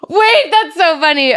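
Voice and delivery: high loud gasp, then slow amused wait; gasp, then savour the joke slowly; surprised gasp. Real, not acted. Sees the last text.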